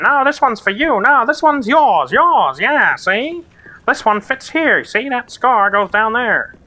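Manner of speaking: high-pitched, silly skeleton voice; cartoonish skeleton squeal with drawn-out words. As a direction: bicker like goofy skeletons in a high, swooping voice. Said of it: "silly skeleton voice".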